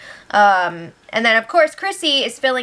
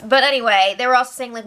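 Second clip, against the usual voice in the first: normal talking voice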